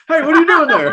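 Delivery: very high, loud, laughing mock yell; hammed-up high giddy hollering; excited dog voice. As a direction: yap it out like a dog with its head out the window. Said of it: "like a dang dog with his head out the window".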